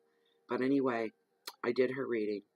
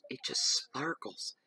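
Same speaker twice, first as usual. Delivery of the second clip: slow, hushed and breathless over the sparkle; awed whisper; 6 semitones higher, slower than usual. Real, not acted. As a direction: whisper it like it's precious. Marvelling at sparkly stones.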